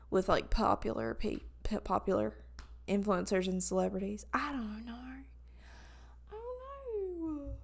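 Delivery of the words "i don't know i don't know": high breathy whisper trailing off. Real, not acted. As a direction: whisper it, rising into a squeak. Whispered then high squeak.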